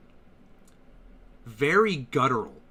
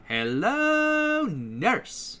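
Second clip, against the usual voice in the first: cartoon catchphrase; 10 semitones higher, much slower than usual.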